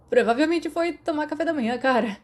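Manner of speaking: casual shout from bed to a friend across the room; shouted back. Acted. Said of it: "Sirius gritou de volta".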